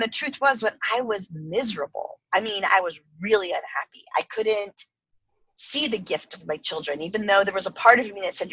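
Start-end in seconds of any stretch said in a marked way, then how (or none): none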